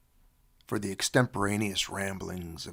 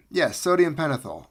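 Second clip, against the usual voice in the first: normal talking voice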